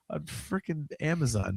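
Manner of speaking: normal talking voice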